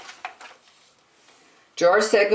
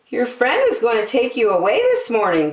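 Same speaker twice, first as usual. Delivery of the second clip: higher, kindly nurse voice; nurse's voice; 7 semitones higher than usual. A narrator's reading. Nurse telling George his friend is coming, kindly. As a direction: tell him gently he's leaving. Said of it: "said the nurse".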